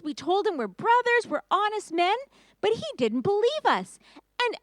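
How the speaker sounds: high, breathless report; agitated brothers. Acted. Brothers tell father Jacob about Egypt, high and agitated. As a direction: tumble out the news, pitched high. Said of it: "They said".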